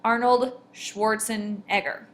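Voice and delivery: "Arnold Schwarzenegger" slowly, beat by beat; "Arnold Schwarzenegger" with two stresses in the surname; exaggerated stresses. Shown as a demonstration. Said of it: "There's two stresses".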